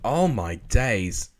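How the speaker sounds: usual speaking voice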